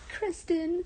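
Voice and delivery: loud, high delighted cry of a name; excited exclaim. Real, not acted. Recognizes the queen's name excitedly.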